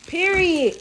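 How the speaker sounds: high, loud final word; emphatic. Real, not acted. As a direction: drop it like a verdict. Emphatic approval.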